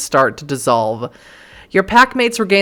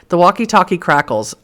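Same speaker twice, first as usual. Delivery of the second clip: ordinary delivery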